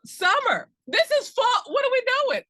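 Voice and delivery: high, loud, baffled protest; incredulous outburst. Real, not acted. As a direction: blurt it out in loud disbelief. Baffled outburst at the word summer.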